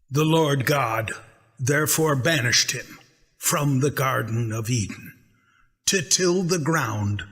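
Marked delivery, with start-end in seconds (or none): none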